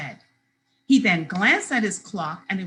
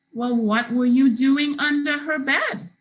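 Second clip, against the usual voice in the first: ordinary delivery, nothing marked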